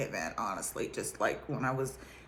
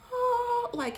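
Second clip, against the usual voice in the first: ordinary talk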